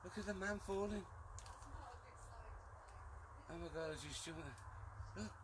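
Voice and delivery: ordinary talk, nothing marked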